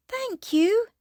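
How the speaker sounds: high, slow giraffe voice; high animal character voice. Acted. Giraffe thanks George.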